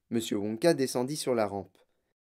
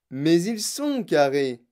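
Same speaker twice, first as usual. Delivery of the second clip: loud, higher, slow insistence; monsieur Wonka; 4 semitones higher, 6 dB louder, slower than usual. A narrator's reading. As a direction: stress it slowly and loudly. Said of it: "dit monsieur Wonka". (insistance lente, voix forte)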